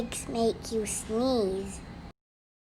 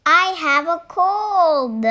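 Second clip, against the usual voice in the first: delighted; 6 semitones higher, 6 dB louder than usual. Acted. Gerald happily shares great news.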